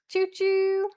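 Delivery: high sing-song conductor call; chugging toy train voice. Pretend. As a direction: call all aboard like a cheery conductor. Streamer train hype call.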